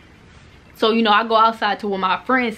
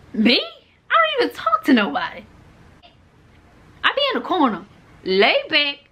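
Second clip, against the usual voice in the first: high-pitched, indignant protest; incredulous denial; 8 semitones higher than usual. Retold or imitated. Student accused of bullying answers principal, high incredulous protest. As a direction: squeak out your innocence in disbelief.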